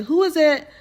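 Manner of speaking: called out high and loud through a closed door; calling out. Retold or imitated.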